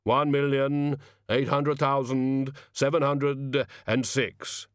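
slow, measured voice of Death; solemn Death. Acted. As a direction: count it out with slow gravity.

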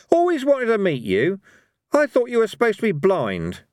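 high, lively character voice; pitched well above the narration, jaunty; Cohen, bold and cheeky. Acted. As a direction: needle a god with cheeky confidence.

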